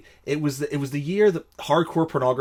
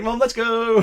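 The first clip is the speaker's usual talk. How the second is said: urgent escape; 10 semitones higher, 5 dB louder than usual. Retold or imitated. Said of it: "I was like".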